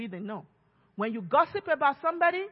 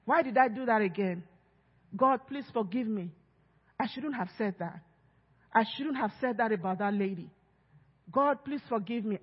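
hushed, lower, sorry plea; soft contrite confession to God; remorseful prayer; 6 semitones lower, 6 dB quieter than usual. Retold or imitated. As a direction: pray quietly like a guilty believer asking forgiveness. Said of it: "falls into that sin and says".

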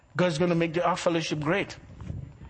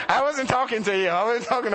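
laughing; 3 semitones higher than usual. Real, not acted. Still laughing, teasing Angela.

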